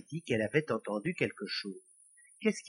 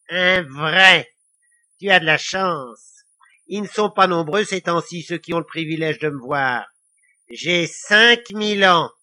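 louder, self-important devil voice; boastful devil; 2 semitones higher, 8 dB louder than usual. A narrator's reading. Devil boasting of his age to Lily, self-important. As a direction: brag like an ancient little devil.

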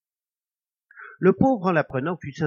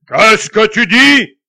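loud, slow, higher menacing question; terrible voice; 9 semitones higher, 20 dB louder, much slower than usual. A narrator's reading. Ghost hearing he is dead, slow terrible roar. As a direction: roar it out slowly like a threat. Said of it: "d'une voix terrible".

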